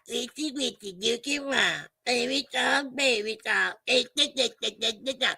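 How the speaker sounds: lightly voiced baby babble; mock baby babble. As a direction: coo like a baby to mock him. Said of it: "you still on that baby shit".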